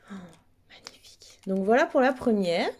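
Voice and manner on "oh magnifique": breathy whisper of awe; whispered, marveling. Real, not acted. Admiring keychain up close.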